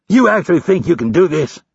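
high-pitched incredulous challenge; disgusted disbelief. A narrator's reading. Instructor doubts the recruit can do this, disgusted. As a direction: question him in scornful disbelief. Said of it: "shook his head in disgust".

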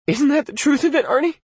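high, pleading voice; tearful plea. Acted. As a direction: ask through tears. Lee pleading, near tears.

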